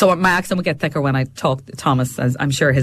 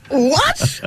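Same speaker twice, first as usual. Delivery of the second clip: high-pitched mock-baffled yelp; baffled husband; 13 semitones higher than usual. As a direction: imitate a bewildered husband, voice shooting up. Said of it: "her husband was like".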